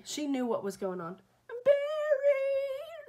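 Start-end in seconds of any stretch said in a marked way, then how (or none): 1.5-3.1 very high, loud, long mock shriek; imitating child screaming. Little girl at her bedroom window after the dog, screaming. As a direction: shriek it out like a crying little kid. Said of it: "Screaming it into the night".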